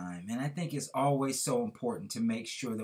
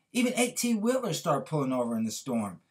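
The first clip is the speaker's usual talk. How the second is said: normal talking voice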